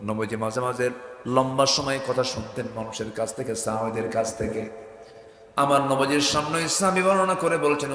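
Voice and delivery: ordinary talk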